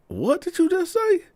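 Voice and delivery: very high-pitched incredulous question; shocked disbelief. Retold or imitated. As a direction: act out her shock, voice way up. Woman acted, shocked at remark.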